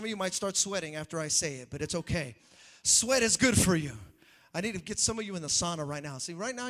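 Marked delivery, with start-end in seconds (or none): 2.8-3.9 loud punchline. Real, not acted. Preacher punching a line.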